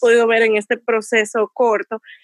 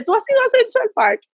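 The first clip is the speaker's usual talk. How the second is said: teasing question; 11 semitones higher than usual. Retold or imitated. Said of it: "Y empecé a preguntarle".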